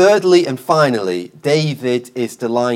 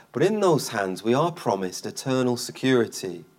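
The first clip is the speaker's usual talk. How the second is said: ordinary delivery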